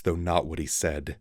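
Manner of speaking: plain reading voice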